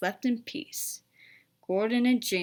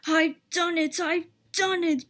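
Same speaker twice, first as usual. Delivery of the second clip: higher, breathless triumph; excited puffing; 7 semitones higher, 3 dB louder than usual. A narrator's reading. Edward getting the train moving, excited triumph. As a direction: burst with joy at the pull-away. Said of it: "puffed Edward, his wheels spinning with excitement".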